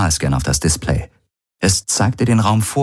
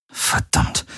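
voiceless, much softer swear; muttered curse; 5 dB quieter than usual. Acted. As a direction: breathe the curse under your breath. Frustrated curse at bad news.

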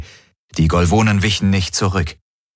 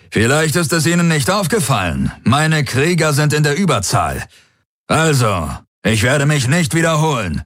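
raised-pitch, forceful official demand; barely suppressed anger; 8 semitones higher than usual. A narrator's reading. Commander relieving Ylana of command, suppressed rage. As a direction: announce it formally, rage held in. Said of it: "mit schlecht unterdrückter Wut".

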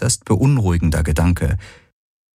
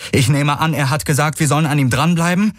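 calling out; 9 semitones higher than usual. A narrator's reading. Rider checks the leader's order mid-gallop, calling out. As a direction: call across to him, pitched well up. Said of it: "rief Kirai".

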